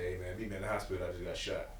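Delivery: soft, low, casual calm; calm and peaceful. Retold or imitated. Shot friend on phone, oddly calm and low. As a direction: say it as if nothing happened. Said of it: "He said".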